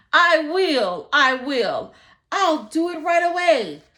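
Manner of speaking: loud, high eager promise; eager agreement. Acted. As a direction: jump at the offer with relief.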